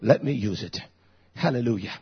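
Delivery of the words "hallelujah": normal talking voice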